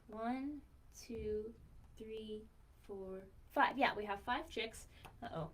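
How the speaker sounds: plain reading voice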